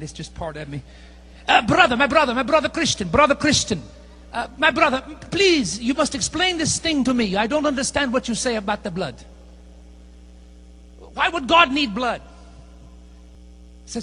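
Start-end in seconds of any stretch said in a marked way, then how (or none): 1.5-12.2 lightly put-on Middle Eastern accent, earnest and puzzled. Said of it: "I gotta do the accent".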